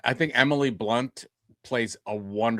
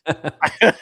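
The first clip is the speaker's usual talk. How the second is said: laughing; 5 semitones higher, 7 dB louder than usual. Real, not acted. Laughs after singing.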